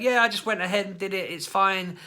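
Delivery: put-on high, loud offhand kid voice; mimicking son. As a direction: toss it off like a boy. Son's casual answer mimicked.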